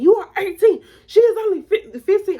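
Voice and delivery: very high, loud motherly scolding; mama yelling. Retold or imitated. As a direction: imitate an outraged mom comparing ages. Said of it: "she's yelling at both of us".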